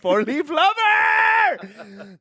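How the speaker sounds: high, drawn-out delighted shout; excited shout. Real, not acted. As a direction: shout it with glee. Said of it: "That's so good".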